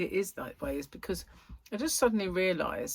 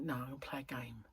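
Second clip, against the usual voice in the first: soft unvoiced whisper to herself; 5 semitones lower, 13 dB quieter than usual. Real, not acted. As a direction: breathe the words with no voice. Private thought, whispered aside.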